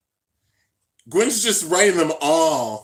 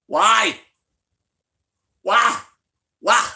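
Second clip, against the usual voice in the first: loud, higher repeated cry; despairing why; 7 semitones higher, 6 dB louder, much slower than usual. Real, not acted. Fan protesting the boy reveal, loud repeated why. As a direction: wail each why at the screen.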